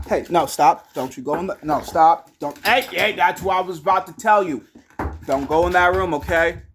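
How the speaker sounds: loud, firm commands; scolding the dog. Real, not acted. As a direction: stop the dog from going in.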